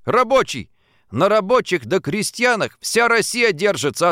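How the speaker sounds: high, emphatic, ringing oratory; impassioned speech. A narrator's reading. Antoshin urging Fadeikin to take pride as worker, fervent. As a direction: drive each title home with conviction.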